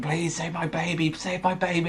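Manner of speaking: mild mock scream for her baby, pitched high; mock desperate scream. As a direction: imitate a mother's frantic plea without real volume. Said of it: "[name] keeps screaming".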